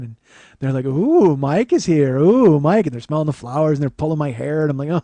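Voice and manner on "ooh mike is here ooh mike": loud, singsong mock cooing; teasing. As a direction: imitate dancers teasing with drawn-out oohs. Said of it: "they're teasing me".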